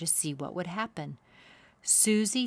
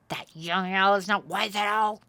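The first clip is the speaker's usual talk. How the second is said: louder angry beaver voice; shouted; 2 semitones higher, 5 dB louder than usual. A narrator's reading. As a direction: shout it soaking wet. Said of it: "he shouted".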